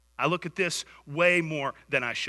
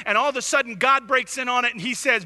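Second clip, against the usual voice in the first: rising, louder, driving sermon voice; fervent preaching; 7 semitones higher, 6 dB louder than usual. Acted. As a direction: push the story hard from the pulpit. Preaching at full force.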